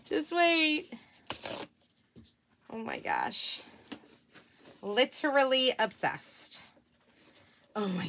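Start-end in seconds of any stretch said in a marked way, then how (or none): none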